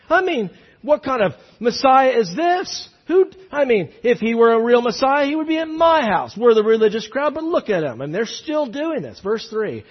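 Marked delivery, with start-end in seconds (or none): 0.1-8.0 higher-pitched, affronted religious protest; mock indignation. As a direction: complain like an offended Pharisee. Mimics indignant Pharisees' complaint.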